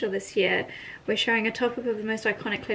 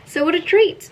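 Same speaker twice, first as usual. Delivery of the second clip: delighted; 7 semitones higher, 7 dB louder than usual. Real, not acted. Delighted with gift.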